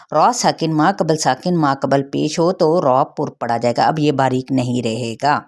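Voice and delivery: ordinary delivery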